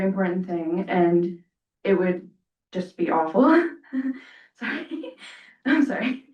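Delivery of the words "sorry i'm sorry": giggling, nervous apology; nervous laughter. Real, not acted. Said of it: "I'm a little nervous".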